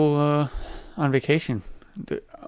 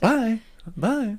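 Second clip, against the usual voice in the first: mock movie farewell; 10 semitones higher than usual.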